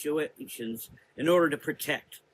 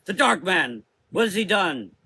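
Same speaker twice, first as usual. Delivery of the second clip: louder, higher alarmed cry; alarmed outburst; 5 semitones higher, 7 dB louder than usual. A narrator's reading. Mother Azra blaming the Dark Man, alarmed outburst. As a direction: blurt it out in alarm.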